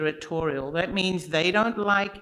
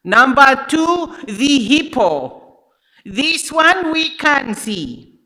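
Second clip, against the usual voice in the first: put-on slow, loud African-accented safety warning; imitating a guide's loud African accent; imitated African accent; 8 semitones higher, 12 dB louder, slower than usual. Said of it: "I'm not gonna do that accent the whole time".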